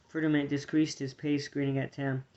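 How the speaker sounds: usual speaking voice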